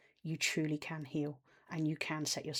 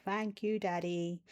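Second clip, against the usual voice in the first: put-on baby voice; 2 semitones higher, slower than usual. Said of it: "that's how my inner child sounds".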